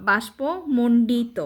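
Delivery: slow, syllable by syllable, বাষ্পমণ্ডিত; slow spelled-out word. Shown as a demonstration. Teacher reading a hard word to a child, slowly. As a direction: say বাষ্পমণ্ডিত slowly, each part clear. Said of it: "এই যে বাষ্পমণ্ডিতটা কীভাবে?".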